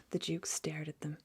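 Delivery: plain reading voice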